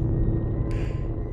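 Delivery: breathy nervous laughter; soft relieved chuckle. Real, not acted. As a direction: laugh off the fright. Laughs after scare.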